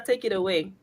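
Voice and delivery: usual speaking voice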